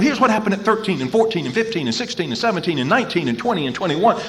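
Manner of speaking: usual speaking voice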